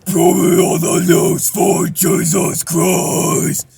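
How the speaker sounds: slow, loud rough scream; false chord scream. Shown as a demonstration. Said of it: "something like that".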